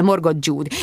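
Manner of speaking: plain reading voice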